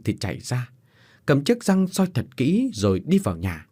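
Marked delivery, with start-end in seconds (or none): none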